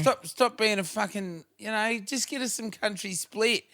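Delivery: put-on whiny kid voice. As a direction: whine like a spoiled kid. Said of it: "that whiny kid's voice".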